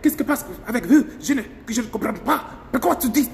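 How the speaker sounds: fast, playful mock French accent. Vlogger imitating the syncopated Toulouse French, fast and playful. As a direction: rattle off French like a Toulouse local. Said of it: "speak French like that".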